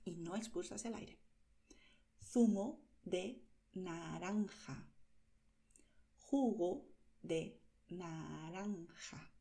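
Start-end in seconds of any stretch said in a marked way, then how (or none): 2.2-4.9 Zumo said slowly with the z as a lisped th; slow careful zumo. Shown as a demonstration. Teacher modelling Zumo de naranja slowly for learners. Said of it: "Si yo exagero el movimiento". 6.2-9.3 Jugo said slowly with a throaty j; slow careful jugo. Shown as a demonstration. Teacher modelling Jugo de naranja slowly for learners. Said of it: "Si yo exagero el movimiento".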